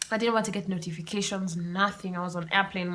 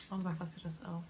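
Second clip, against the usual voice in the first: much softer, drifting off; soft repeat; 11 dB quieter, faster than usual. Real, not acted. Admitting the loss to viewers, much softer. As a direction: let it fall away softly.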